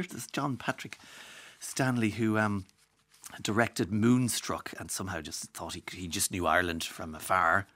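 ordinary talk

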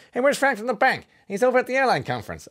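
high-pitched mock mobster; movie gangster. Radio host spoofs a Scorsese film scene, high mock-gangster voice. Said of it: "your gangster name if you're in a Martin Scorsese".

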